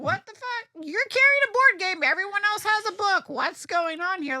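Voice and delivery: loud, high-pitched bafflement; incredulous friend. Retold or imitated. Best friend questioning the board game at a signing, incredulous.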